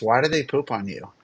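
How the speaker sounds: usual speaking voice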